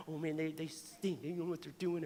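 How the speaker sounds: mock grumbling, very soft and low; hushed grumbling. Churchgoer griping about bad service behind their backs, hushed mock grumble. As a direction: grumble under your breath, acting it out.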